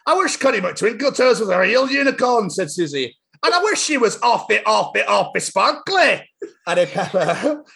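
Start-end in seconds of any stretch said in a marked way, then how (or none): 0.1-6.3 put-on high Scots kids' voices, slowing, stifling laughs; Scottish Peppa characters. Reading Peppa book aloud, Scottish child voices. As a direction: play the wee girls in broad Scots. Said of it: "I'm gonna do it in my accent".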